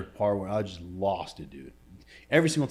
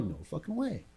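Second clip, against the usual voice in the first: mild, higher-pitched incredulity, kept soft; retold disbelief; 6 semitones higher, 6 dB quieter than usual. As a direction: imitate your own stunned reaction under your breath. Retold disbelief at doctor's claims.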